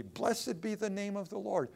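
ringing sermon proclamation; preaching. Acted. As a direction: declare it to the congregation.

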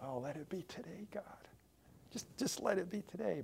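breathless whispered plea to God; a private begging whisper to God; whispered prayer. Retold or imitated. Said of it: "You ever pray like that?".

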